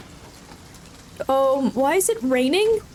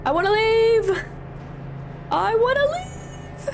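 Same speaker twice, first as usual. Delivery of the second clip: high, pleading whine, slowing down; whimpering plea; 8 semitones higher, slower than usual. Real, not acted. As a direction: whine it in fear. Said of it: "I'm genuinely scared".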